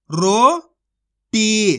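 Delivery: slow, stretched roti with a falling end; roti said slowly, sound by sound; drawn-out demo word. Shown as a demonstration. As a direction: draw out roti for learners. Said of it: "तो मिलके बन जाएगा".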